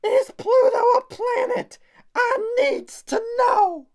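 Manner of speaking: very high, loud squeaky plea; desperate questioner. Acted. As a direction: squeal the question, dragging the last words. Acted desperate question from audience.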